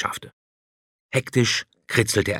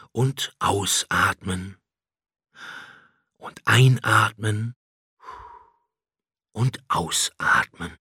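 slow, calm breathing cues; slow breathing guidance; slower than usual. A narrator's reading. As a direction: guide the breaths slowly. Dragon guides breathing exercise. (langsame Atemanleitung)

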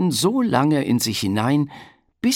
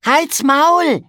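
high, loud yell at the jay; shouting; 7 dB louder than usual. Acted. Piggledy yells at the scolding jay, high and loud. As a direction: shout it up into the tree. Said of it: "rief Piggledy".

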